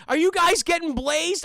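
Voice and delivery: high, loud cartoon slacker quote; Shaggy. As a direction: put on the goofy stoner sidekick. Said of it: "first line dialogue from Shaggy's mouth is".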